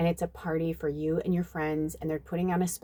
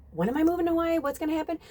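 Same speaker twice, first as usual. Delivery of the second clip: eager asking; 10 semitones higher, much faster than usual. Retold or imitated. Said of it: "where I'm like".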